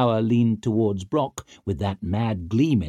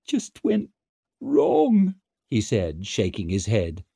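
high, dazed and numb; 10 semitones higher, slower than usual. A narrator's reading. Dancer dazed.

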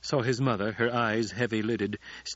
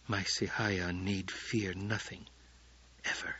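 softer, slow tender whisper; 2 semitones lower, 7 dB quieter than usual. A narrator's reading. Paul soothes Chani in a soft slow whisper. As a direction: reassure her gently. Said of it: "he whispered".

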